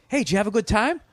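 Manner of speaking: high, sing-song fake friendly host; mock chummy host. Mocking the host to his guest, high fake cheer. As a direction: chirp it like a phony pal.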